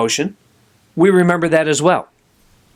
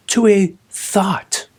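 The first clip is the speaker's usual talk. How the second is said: whisper; 3 semitones higher, much slower than usual. Real, not acted. Whispered end of remark.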